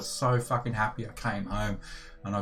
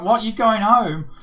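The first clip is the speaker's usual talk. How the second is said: surprised; 12 semitones higher, 8 dB louder than usual. Retold or imitated. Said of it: "And I'm like".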